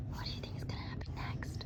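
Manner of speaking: hushed, quick conspiratorial whisper. Real, not acted. Reader asks children what happens next, whispering. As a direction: whisper it like a secret question.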